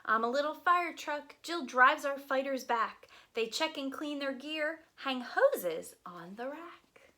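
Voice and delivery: lively, sing-song picture book reading; reading to children. Acted. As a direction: read it aloud to a group of little kids.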